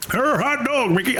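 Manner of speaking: a clumsy try at a classic film actor; Jimmy Stewart impression. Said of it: "I can't do Jimmy Stewart".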